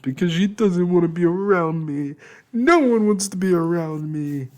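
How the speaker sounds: high, loud tearful wail. Acted. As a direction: sob it out, feeling unwanted. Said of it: "I wailed".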